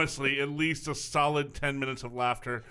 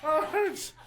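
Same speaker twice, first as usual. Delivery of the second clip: higher, laughing through the words; aching from laughter, words squeezed out; after laughing hard; 9 semitones higher, slower than usual. Real, not acted. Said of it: "oh, my stomach hurts".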